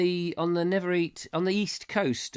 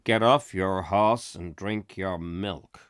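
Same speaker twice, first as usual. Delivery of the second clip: low, slow American cowboy drawl; John Wayne; 7 semitones lower, slower than usual. Acted. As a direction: drawl it like an old western star. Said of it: "as I'm sure every John Wayne horse".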